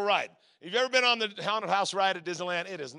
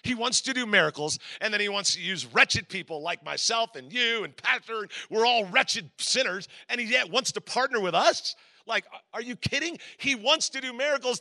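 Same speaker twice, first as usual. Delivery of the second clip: normal talking voice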